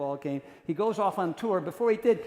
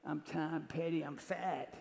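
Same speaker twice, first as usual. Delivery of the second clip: [name] voice; 7 dB quieter, slower than usual. Retold or imitated. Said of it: "There's Tom with a cigarette".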